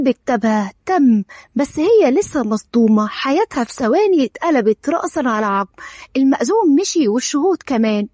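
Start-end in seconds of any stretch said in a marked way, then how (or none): none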